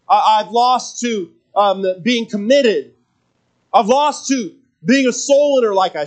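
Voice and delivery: heartfelt sermon delivery; preaching. Acted. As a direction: lean into each line from the pulpit. Preaching to congregation.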